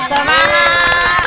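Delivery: slow, loud rallying call; drawn-out cheer. Real, not acted. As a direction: stretch it out to fire them up. Host cheers contestants.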